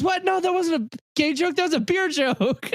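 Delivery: high mock movie voice. Friend quoting a movie scene, squeaky high mock protest. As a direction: pitch it way up like a goofy movie character. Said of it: "He goes".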